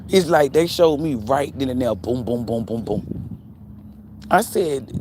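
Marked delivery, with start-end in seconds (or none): none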